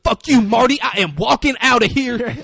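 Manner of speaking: very loud, high defiant yell; acted screaming. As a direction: shout defiantly at everyone holding you back. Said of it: "he's just fucking screaming".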